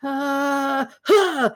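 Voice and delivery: high mock gasps; imitated strained gasp. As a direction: gasp out two sudden breaths. Imitates Spock's exertion at the box.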